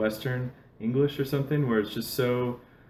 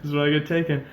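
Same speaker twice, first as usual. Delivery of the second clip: higher, louder mock terror; imitating inner panic, much louder and higher; 6 semitones higher, 10 dB louder than usual. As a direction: act out a hammed-up fear of being kidnapped. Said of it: "I'm like".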